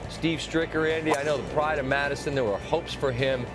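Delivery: normal talking voice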